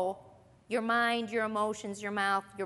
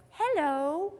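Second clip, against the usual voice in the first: suddenly sweet, higher and louder hello; pleasant phone voice; 5 semitones higher, 5 dB louder than usual. Acted. Answering the phone mid-argument, in a put-on pleasant voice. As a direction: sing out a pleasant, composed greeting. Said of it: "answer in a pleasant voice".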